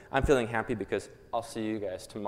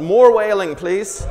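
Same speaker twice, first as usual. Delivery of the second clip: loud call; 9 semitones higher, 14 dB louder, slower than usual. Real, not acted. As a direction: shout it over a wailing crowd, much louder. Shouting over the wailing crowd.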